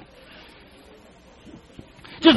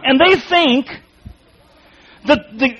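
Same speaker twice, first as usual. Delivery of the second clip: ordinary talk, nothing marked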